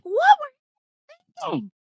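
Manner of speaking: high-pitched mock outrage; retold scolding that shoots up high; imitated going off. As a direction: imitate an exasperated partner going off. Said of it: "he just goes off".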